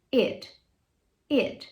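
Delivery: short id syllable; ending sound id. Shown as a demonstration. Teacher drilling the id ending. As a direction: tap out id. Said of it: "som de I D".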